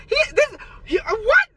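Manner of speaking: high, flustered stammer; mock stammering groomer. Groomer fumbling when caught, mocked in a high voice.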